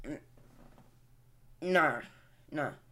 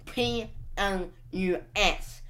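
spelled out; much slower than usual. Shown as a demonstration. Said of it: "Not the play, plus symbol, the word plus".

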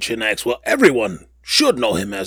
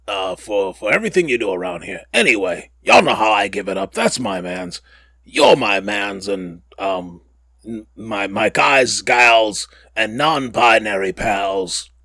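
ordinary talk

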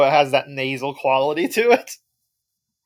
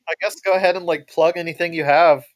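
ordinary talk

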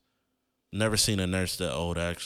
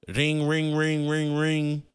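high, even-pitched rings; rapid repeated rings, held high; imitated phone ringing; 6 semitones higher, slower than usual. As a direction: mimic a ringing telephone.

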